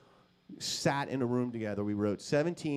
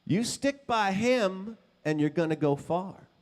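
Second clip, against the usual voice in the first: higher, louder, slow fatherly advice; lightly voiced father; 7 semitones higher, 5 dB louder than usual. Father advising his son about Kip, lightly voiced. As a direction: lay down a father's verdict. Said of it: "he said".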